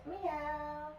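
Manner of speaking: high mock cat meow; playful meow. Yoga teacher cueing cat pose for the class, playful meow. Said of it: "Think cat".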